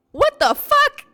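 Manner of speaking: high-pitched, full-volume exclamation; loud outraged shout. Retold or imitated. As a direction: blast it out, scandalized. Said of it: "I said that shit loud as fuck".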